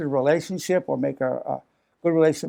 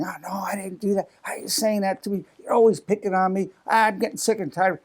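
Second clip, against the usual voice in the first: starts in a whisper, then a hurt, defensive protest; defensive whining; 4 semitones higher than usual. Retold or imitated. Feeling criticized, a hurt defensive protest. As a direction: act out your inner defensive voice, feeling picked on. Inner defensive reaction acted out.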